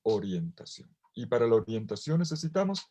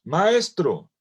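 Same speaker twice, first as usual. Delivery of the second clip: mock shout; 7 semitones higher, 6 dB louder, slower than usual. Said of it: "le mandaron un grito a Jesús".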